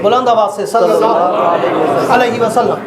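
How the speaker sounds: loud call for the crowd to answer; rally the congregation to reply; preacher's call. Acted. Calling crowd to respond. (मजमे से ज़ोर से जवाब मँगवाना)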